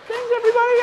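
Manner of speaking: mock high voice. Host signing off in funny voice.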